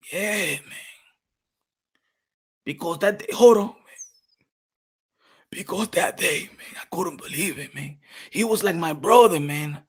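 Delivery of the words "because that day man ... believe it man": ordinary delivery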